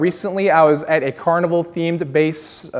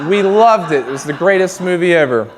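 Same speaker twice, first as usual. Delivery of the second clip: gushing; 3 semitones higher, 5 dB louder than usual. Retold or imitated. Repeats the gushing.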